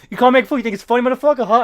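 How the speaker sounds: villain rounds on the snickering guard, mock rage; mock furious villain. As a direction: bark it like an offended mobster boss. Villain threatens a henchman, retold.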